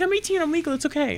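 high, bright, breezy mock girl voice; chirpy reassurance. Fan reassuring the mascot, light high girl voice. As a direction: brush it off cheerfully. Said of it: "She's like".

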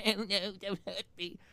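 high, squeaky begging voice; groveling plea. Acted. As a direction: whimper it while groveling. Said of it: "starts groveling".

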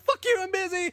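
cartoonish high voice, dismissive; mock rude brush-off. Messenger dismissing Marty's questions, mock rude.

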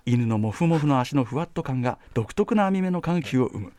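documentary narration. Shown as a demonstration. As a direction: read it like the narrator of a train travel show. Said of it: "世界の車窓からみたいな".

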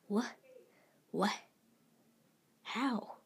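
halting one-word questions with long gaps; stuttered disbelief. A narrator's reading. Thomas faces Peter unexpectedly, halting stuttered questions. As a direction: falter between each stunned word. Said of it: "Thomas stuttered".

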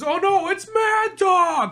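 very high, slow mock alarm; alarmed cry. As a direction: cry out like a panicked bystander.

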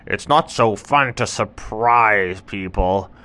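slow, loud humbled tiger; sheepish tiger. Acted. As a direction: admit it slowly. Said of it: "And the tiger said".

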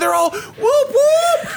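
very high, whooping crowd yell; excited crowd cheer. Acted. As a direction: whoop like a thrilled audience. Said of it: "It's like they're excited".

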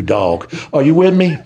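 ordinary delivery

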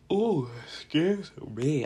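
stretched out through a real yawn. Real, not acted. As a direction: yawn right through the words, slowly. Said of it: "a yawn".

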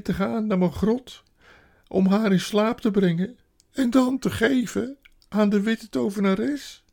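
high, loud self-reproach; woeful creature crying out; tearful wailing faun. A narrator's reading. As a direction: bewail your own wickedness. Weeping faun confesses kidnapping.

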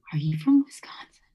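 breathy, whispered mock amazement; astonished whisper. Asking a new Canadian acquaintance, retold astonished whisper. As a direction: whisper it like a stunning discovery.

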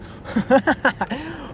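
risa aguda de sí mismo. Real, not acted. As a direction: suelta una carcajada alta y breve. Laughs at his own thought. (carcajada aguda al recordar sus piernas)

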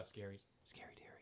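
low, hushed ghost-story whisper; spooky whisper. Pretend. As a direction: whisper it like a scary secret.

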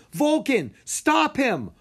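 high, loud command to his dragon; urgent yell. A narrator's reading. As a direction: yell the order to stop him. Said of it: "Rory yelled".

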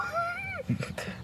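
sudden squealing laugh; high laugh burst. Real, not acted.

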